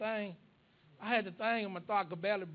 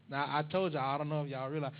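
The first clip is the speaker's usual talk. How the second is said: ordinary talk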